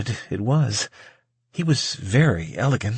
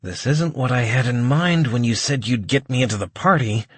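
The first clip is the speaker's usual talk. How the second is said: ordinary delivery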